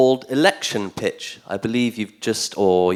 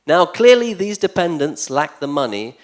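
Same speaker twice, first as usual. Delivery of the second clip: declaimed satirical poem, emphatic with formal pauses; mock election speech; 2 semitones higher, 4 dB louder than usual. Poet playing a candidate pitching to voters, mock-earnest speechifying. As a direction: deliver it like a candidate's pitch, pressing the key phrases.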